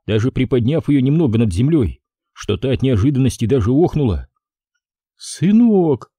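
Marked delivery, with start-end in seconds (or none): 5.2-6.1 high emotional gasp of son; overwhelmed mother breathing the word; tearful exhale; breathed out. A narrator's reading. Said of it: "выдохнула Зинаида Максимовна".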